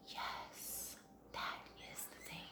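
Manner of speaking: breathy awed whisper of discovery; dreamy whisper. Retold or imitated. Mom whispering in awe at finding the perfect planner. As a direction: whisper it like you found treasure. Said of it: "you're like".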